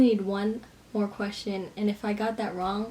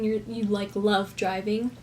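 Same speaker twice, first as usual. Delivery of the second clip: usual speaking voice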